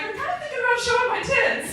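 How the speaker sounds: put-on high, giggly girly voice; coy girly. To the forum guys, high coy giggly tease. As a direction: squeak it out coyly like a teen. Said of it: "And I'm like".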